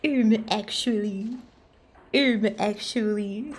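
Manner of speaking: slow smug "um actually"; nerdy know-it-all voice. Pretend. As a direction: drawl it like a pedant. Nerd voice again, repeated.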